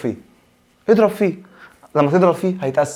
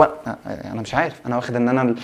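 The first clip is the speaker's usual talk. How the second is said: normal talking voice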